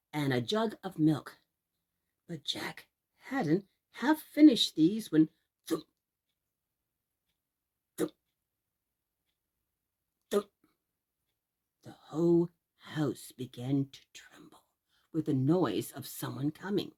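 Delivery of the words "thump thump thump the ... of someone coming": lower, whispered narration; hushed suspense. A narrator's reading. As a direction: hush them for the ogre coming. Suspense before the ogre.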